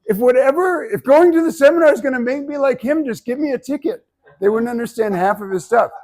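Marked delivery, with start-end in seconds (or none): 0.1-4.1 high, loud mock bewildered voice; confused audience member. Coach mocking confused seminar attendees, high and loud. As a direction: play a baffled listener, exasperated. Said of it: "people would be like".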